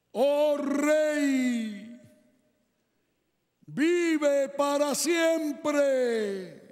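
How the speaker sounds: far-off, very slow and steady call; distant but firm voice. Acted. Daniel answers the king from the bottom of the den, far off and firm. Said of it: "oye una voz lejana, aunque firme".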